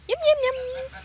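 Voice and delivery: high sing-song. Real, not acted. Feeding the pig.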